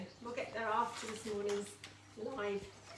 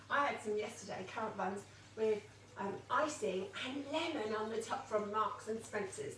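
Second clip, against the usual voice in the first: normal talking voice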